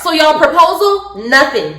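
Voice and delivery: ordinary delivery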